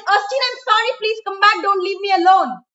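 high, loud, pleading cry; desperate plea. Acted. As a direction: beg her to come back, voice straining high. Begging vanished bride to return.

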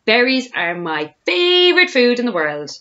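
high, loud, gushing; singsong delight. A narrator's reading. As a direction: swoop up on favourite with glee. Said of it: "sang Roly-Poly Bird".

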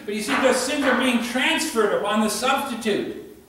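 loud, high, ringing sermon delivery; full-force preaching. Acted. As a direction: preach it out to the back pews with fire. Preacher explaining the sacrifice.

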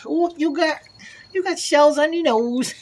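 high cooing voice to an animal. Real, not acted. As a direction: coo at the animal. Talking to raccoon, high pet voice.

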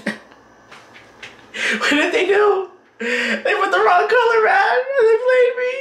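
very high, hammed-up crying through laughter; mock wailing. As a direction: wail in exaggerated, laughing despair. Hammed-up despair at wrong dye color.

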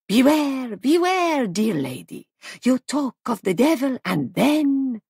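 high, loud mock warning; theatrical foreign guest. A narrator's reading. Foreign guest startling a lady from behind, high and theatrical. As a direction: burst in with a dramatic, sing-out "beware".

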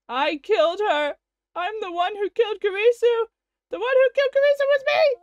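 from plain to a fast, high, loud scream; building anguished shout. Acted. Okabe realizing he killed Kurisu, building to a scream. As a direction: let the horror build to a yell.

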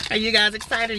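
normal talking voice

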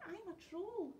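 high, meek little voice; very small voice. A narrator's reading. Troll explaining himself to the pirates, very small voice. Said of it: "said the Troll in a very small voice".

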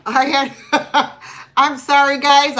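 laughing through an apology. Real, not acted. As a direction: breaks into laughter mid-sentence. Laughs at own eating.

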